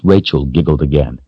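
plain reading voice

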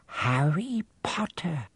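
slow breathless whisper of awe; just breathe the name. Acted. Bartender whispering Harry's name in awe. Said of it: "whispered the old bartender".

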